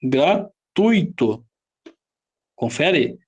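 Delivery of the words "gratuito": "Gratuito" slowly broken into Gra - tui - to; slow teacher's syllable split of "Gratuito". Shown as a demonstration. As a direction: sound out "Gratuito" in three separate chunks. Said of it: "Quantas sílabas?".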